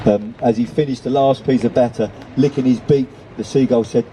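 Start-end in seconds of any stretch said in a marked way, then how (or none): none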